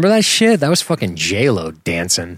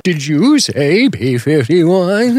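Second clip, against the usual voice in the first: put-on wavery old man voice; 9 semitones higher, 4 dB louder, much slower than usual. Joking over clip.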